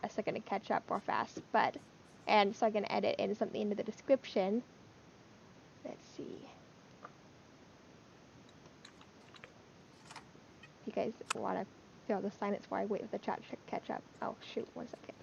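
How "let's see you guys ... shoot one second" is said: whispering. Real, not acted. Waiting silently for chat.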